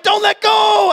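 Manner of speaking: desperate shout. Retold or imitated. Jack shouts his plea to her, desperate. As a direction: cry out as if drowning. Jack's plea retold big.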